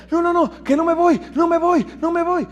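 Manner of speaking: high, panicked no no no; frantic refusal, high and fast; panicked pleading. Retold or imitated. Panicked patient refusing to fly.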